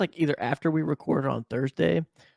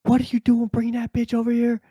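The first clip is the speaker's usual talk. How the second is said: higher, mock-angry neighbor yelling; 10 semitones higher, 4 dB louder than usual. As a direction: ham up a furious man at his door. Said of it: "he's like".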